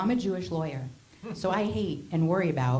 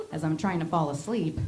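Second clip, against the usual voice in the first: ordinary delivery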